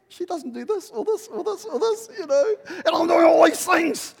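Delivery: high, loud protest near crying; complaining shout. Retold or imitated. Husband protesting to God, loud and high. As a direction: cry out that it's unfair. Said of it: "I said".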